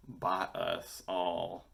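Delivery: usual speaking voice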